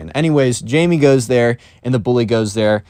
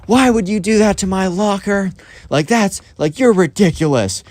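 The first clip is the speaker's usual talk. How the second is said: retold furious bully, pitched higher with a touch of extra volume; a bully snapping at the new kid over his locker, mock furious; 8 semitones higher than usual. Angry kid voice, higher and a bit pushed. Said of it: "and he's furious".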